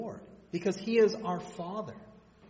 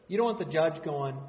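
ordinary delivery

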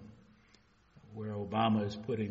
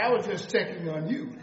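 laughing; 7 semitones higher, 7 dB louder than usual. Real, not acted. Laughing at his own prank.

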